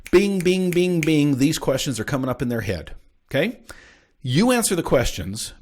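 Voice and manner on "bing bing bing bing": high, louder repeated "bing"; ping out each bing like an alert popping up; imitated pinging. Imitates questions popping up in head.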